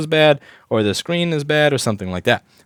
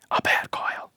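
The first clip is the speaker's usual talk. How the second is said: hushed, unvoiced aside; whispered aside; 6 dB quieter, slower than usual. Real, not acted. Repair tech telling viewers a rare fault, whispered. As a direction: drop to a whisper for the rare case.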